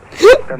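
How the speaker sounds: sudden high burst of laughter; loud laugh. Real, not acted. Streamer bursts out laughing after a misclick, loud laugh. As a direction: crack up loudly at the mistake.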